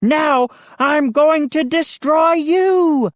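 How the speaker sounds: slow, high hammed-up monster shout; zombie monster. A narrator's reading. Moose attacks Joe as a zombie, hammed-up roar. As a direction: lurch and roar like a movie zombie. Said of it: "like the zombie monster".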